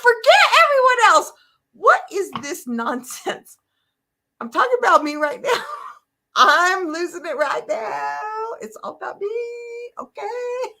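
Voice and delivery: high loud tearful protest, breaking at the end; grief outburst. Retold or imitated. Retelling her own tearful outburst at churchgoers, high and loud. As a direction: let the frustration spill out near tears. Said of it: "I was like".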